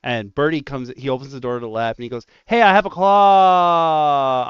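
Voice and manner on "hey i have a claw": loud, high call with the last word drawn out. Retold or imitated. Coworker opening the lab door, calling out. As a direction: sing out a drawn-out announcement.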